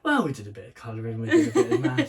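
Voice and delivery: fast, laughing mock-toddler babble; imitating himself as a toddler. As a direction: rattle it off like a chatty two-year-old. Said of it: "that's how I spoke".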